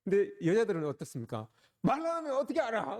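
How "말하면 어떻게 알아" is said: mock wife. Mimicking women's complaint.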